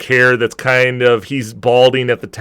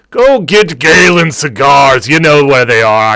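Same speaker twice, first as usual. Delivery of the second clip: orc bartender voice; 4 semitones higher, 7 dB louder than usual. Acted.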